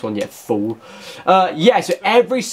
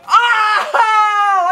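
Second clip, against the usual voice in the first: high, loud disbelieving yelp; real startled cry of joy; shocked scream; 4 dB louder than usual. Real, not acted. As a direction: shriek as the ball drops in. Shock long-range goal goes in.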